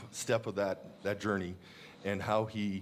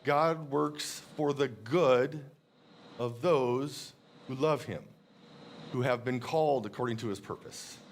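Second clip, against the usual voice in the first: scripture reading. Acted. Reads Romans verse aloud.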